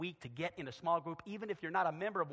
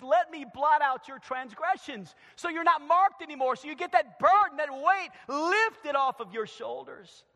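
loud, raised, urgent promise; full-force preaching; 9 semitones higher, 9 dB louder than usual. Acted.

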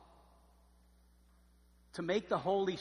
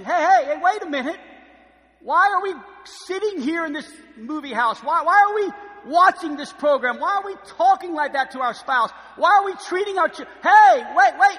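loud, much higher, urgent protest; alarmed calling out; 14 semitones higher, 20 dB louder than usual. Acted. Holy Spirit protesting what we watch, loud and high. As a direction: stop them in their tracks. Spirit objects to movie, program.

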